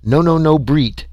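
high-pitched, ecstatic alien voice; ecstatic Tweel. A narrator's reading. Alien delighted at being understood, ecstatic. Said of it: "Tweel was ecstatic".

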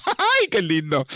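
a sudden, very high squeal of joy with a laugh; delighted. Real, not acted. Preacher recalling the young man being touched, delighted squeal. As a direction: burst out in giddy delight.

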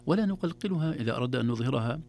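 ordinary talk

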